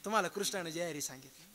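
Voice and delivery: low, hushed confidential delivery; message in ear. Acted. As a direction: drop the voice to tell him privately. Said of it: "कान करा इकडं".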